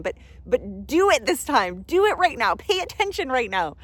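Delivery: loud, high, insistent push to act now. Real, not acted. Coach urging her listeners to act this moment, loud and insistent. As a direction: press them hard to act now.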